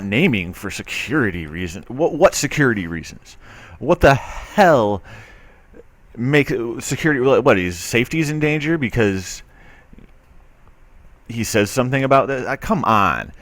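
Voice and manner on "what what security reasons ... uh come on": exasperated. Real, not acted. Indignant rant at the article.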